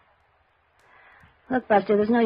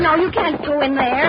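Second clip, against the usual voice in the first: alarmed protest; 7 semitones higher, 6 dB louder than usual. Acted. Clerk blocks detective from office.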